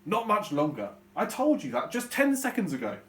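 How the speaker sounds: annoyed dad; angry dad. Shown as a demonstration. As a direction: snap at the kids from the front seat. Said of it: "Dad is gonna reply angrily".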